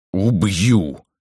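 mildly voiced furious yell; shouted threat. A narrator's reading. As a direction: threaten with a moderate cry. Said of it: "закричал Парейка".

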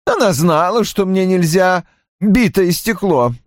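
high-pitched, irritated explanation; querulous high character voice; vexed. A narrator's reading. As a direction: explain with annoyance, pitched well up. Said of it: "пояснил Рой с досадливым жестом".

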